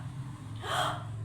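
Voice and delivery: a sharp breathy gasp of alarm; girl gasping as her comb breaks; shocked gasp. Acted. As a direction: suck in air in sudden shock. Girl startled by broken comb.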